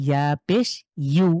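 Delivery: normal talking voice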